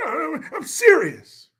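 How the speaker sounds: high, drawn-out mock politician; Newsom. Host correcting his politician impression, slow high-pitched mock sincerity. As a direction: stretch it out high in mock earnest. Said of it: "I meant to be talking like Newsom".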